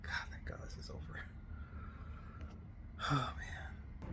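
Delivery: unvoiced relieved whisper. Real, not acted. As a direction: breathe it out in a whisper. Relieved aside after recording.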